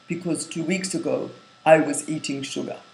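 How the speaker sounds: put-on calm confession in an Indian accent; Gandhi's Indian accent. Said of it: "I hope my Indian accent is okay".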